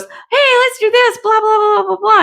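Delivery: high, hyped mock take-charge chatter; pushy knight. The knight barging in on Scorpio, mock hyped-up. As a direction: rattle it off like an overeager know-it-all. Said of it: "the knight comes in and goes".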